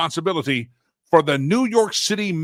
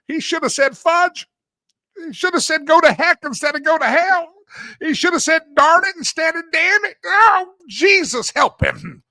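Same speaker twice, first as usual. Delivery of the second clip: high, loud, sustained monotone lament; mock prayer-meeting wail; 12 semitones higher, 5 dB louder than usual. Host imitates prayer meetings upset over swearing, high mock-pious wailing. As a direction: wail it like a scandalized churchgoer praying.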